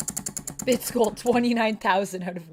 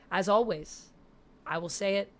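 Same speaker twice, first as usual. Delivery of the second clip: ordinary delivery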